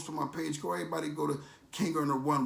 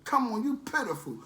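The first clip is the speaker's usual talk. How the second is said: high, scornful cop voice; detective shaming him for blaming his mother, pitched-up scorn; detective's scorn; 7 semitones higher than usual. Retold or imitated. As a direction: sneer it like the disgusted detective. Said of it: "That's how he said it".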